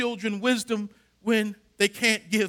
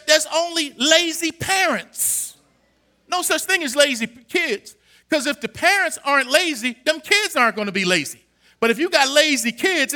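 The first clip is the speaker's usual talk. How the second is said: rising, loud preacher's cadence, driving each line; preaching at full force; 3 semitones higher, 4 dB louder than usual. Acted. Pastor preaching to his church, loud and driving. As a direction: preach it to the congregation with fire.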